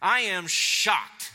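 loud, drawn-out shock as Paul; astonished. Acted. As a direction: stretch it out in stunned disbelief. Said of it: "this is Paul's astonishment".

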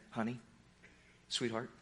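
low, very soft and tender; hushed loving honey; tender endearment. Retold or imitated. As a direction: coax a child gently awake. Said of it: "Jesus taking this little girl by the hand and saying".

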